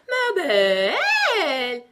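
high, loud, very drawn-out bleating "belle"; long sheep bleat. A narrator's reading. Queen turning into a sheep mid-word, long bleat. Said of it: "Le dernier mot fut un long bêlement".

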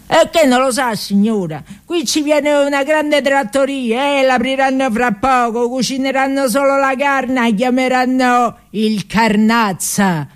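a chatty worker breaking the news; workman's voice. Acted.

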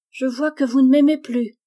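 put-on high, loud complaint in a child's voice; child's reproach. A narrator's reading. Daughter accuses mother of not loving her, high plaintive reproach.

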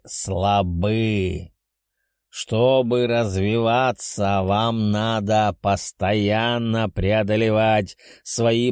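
slow, lecturing beast voice; growling dragon. Acted. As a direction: lecture like a stern old dragon. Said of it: "прорычал дракон".

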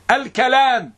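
الكلام with a short "a" after the k, al-kalaam; teacher's demo of الكلام with kaf opened to "ka"; fatha on kaf. Shown as a demonstration. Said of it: "بالفتح الكلام".

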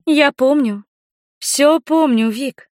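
high female dialogue voice; voiced young woman. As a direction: play the woman answering, pitched high. Voices Inga's reply in dialogue. (высокий женский голос)

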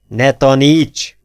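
drawn-out, forceful narrated yell; angry bellow. A narrator's reading. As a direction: roar it slowly at a know-it-all. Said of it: "üvöltött rá Diamond Octave".

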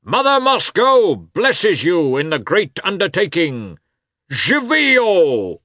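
high, impassioned orator's cry; loud and tearful. A narrator's reading. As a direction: proclaim it slowly, building to a cheer. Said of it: "in a loud and tearful voice".

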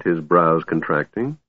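usual speaking voice

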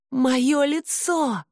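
slow, high anguished cry; cry of pain. A narrator's reading. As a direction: wail it out in pain. Scalded face, cries in pain.

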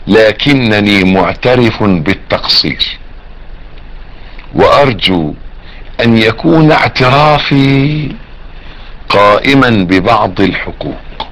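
measured classical Arabic reading, phrase by phrase; slow formal quotation. A narrator's reading. Preacher quoting Ibn al-Jawzi to listeners, slow and formal. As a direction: read it out slowly and deliberately.

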